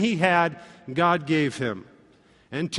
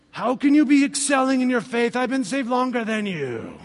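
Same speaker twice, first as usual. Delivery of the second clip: mock indignant whine; 6 semitones higher than usual. Pastor voicing jealous thoughts.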